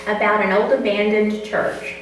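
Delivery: ordinary talk, nothing marked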